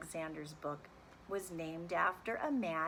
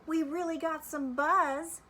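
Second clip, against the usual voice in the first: high, loud, cheery character voice; a bee buzzing with delight after the show; excited Bee; 10 semitones higher, 8 dB louder than usual. Acted. Bee speaks after the contest.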